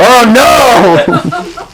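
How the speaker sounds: high, laughing dismay; comic alarm, retold; mock dismay. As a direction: pitch it high, half laughing in dread.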